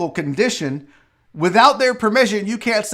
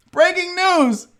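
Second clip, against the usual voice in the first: higher-pitched breaking-news announcement; mock newsreader; 6 semitones higher than usual. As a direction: announce it like an urgent bulletin.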